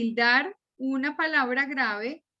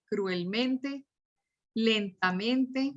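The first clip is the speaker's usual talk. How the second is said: drawn out, word split into parts; slow, broken-up delivery; much slower than usual. Shown as a demonstration. Teacher listing adverbs ending in mente, slowly and in pieces. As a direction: say each adverb slowly, pausing inside it.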